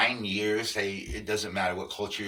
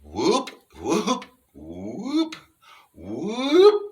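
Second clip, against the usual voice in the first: loud whoops climbing much higher, on the exhale; human whoop; 10 semitones higher, 10 dB louder, much slower than usual. Shown as a demonstration. Showing viewers how humans whoop, loud rising exhaled whoops. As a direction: whoop out on the breath, each one higher. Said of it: "all humans whoop like this".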